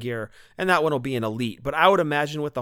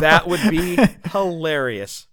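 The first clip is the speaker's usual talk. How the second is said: slow, loud words through laughter; real laughing; 3 semitones higher, 7 dB louder, slower than usual. Real, not acted. Co-host imagines first Hardy figures as zombies, real laughing. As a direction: crack up while answering. Cracking up at co-host's idea.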